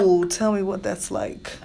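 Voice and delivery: low, slow sultry coo; mock seductive. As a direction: tease it like a sex line. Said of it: "say it sexy".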